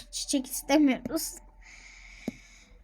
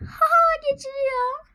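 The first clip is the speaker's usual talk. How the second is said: excited; 13 semitones higher, 4 dB louder than usual. Real, not acted. Excited it scans.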